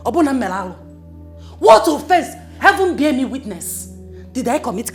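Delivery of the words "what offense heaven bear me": much louder, outraged demand; wife shouting her innocence at the family meeting; indignant shout. Acted. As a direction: throw the question at him. Heated family quarrel.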